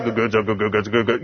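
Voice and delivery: low, soft imitation of muttered grumbling; mock crowd grumbling. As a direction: murmur it like a discontented crowd. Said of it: "it sounds like what it means".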